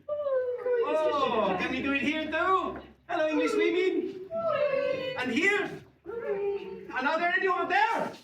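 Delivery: high, delighted calls to the crowd; calling out. Real, not acted. Performer greeting English women round the theatre, high calling. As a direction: sing it out to each side of the room.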